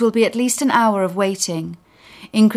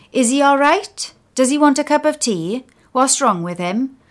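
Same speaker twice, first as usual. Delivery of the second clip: fussing woman; 6 semitones higher, 4 dB louder than usual. Acted. Witch-like women fussing.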